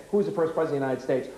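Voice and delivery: fast, run-together question; child's hurried recap. Acted. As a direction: race back through the question as a thinking pupil. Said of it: "They actually have to go".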